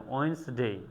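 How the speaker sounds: ওয়েডনেসডে as "ওয়েনসডে", no d; ওয়েডনেসডে clipped to "ওয়েনসডে"; silent d Wednesday. Shown as a demonstration. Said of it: "কখনো ওয়েডনেসডে বলি না". (ডি বাদ দিয়ে ওয়েডনেসডে)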